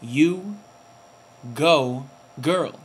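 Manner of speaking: drawn out, each word set apart with pauses; hammed-up "you go, girl", slowly spaced; mock sassy cheer. Vlogger cheering Serena on in a put-on sassy voice. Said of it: "Or whatever they do".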